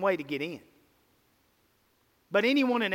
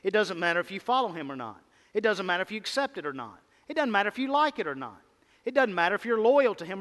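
rapid-fire list; faster than usual. Real, not acted. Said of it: "rapid repeated list".

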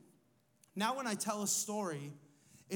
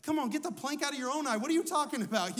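indignant comeback; 5 semitones higher, 4 dB louder, faster than usual. Retold or imitated. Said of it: "and you're like".

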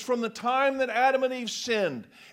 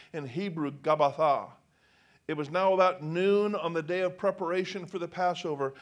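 ordinary delivery

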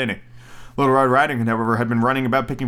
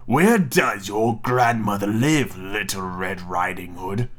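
wolf voice; 4 semitones lower, much slower than usual. Acted. Wolf questions the girl.

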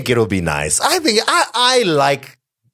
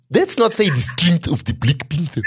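lightly higher put-on African accent; 6 semitones higher than usual. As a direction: ease into a mock African accent. Acting out Danai easing into accent.

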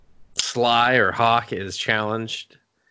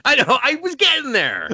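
real high-pitched, louder defensive retort; exasperated protest; 14 semitones higher, 3 dB louder than usual. Real, not acted. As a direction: push back at being interrupted, pitch way up. Cut off, defends himself.

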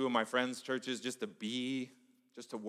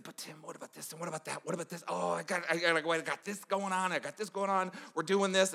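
frantic protest; 7 semitones higher than usual. Retold or imitated. Pastor protesting to mentor.

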